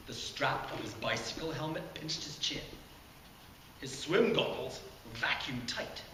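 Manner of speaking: ordinary delivery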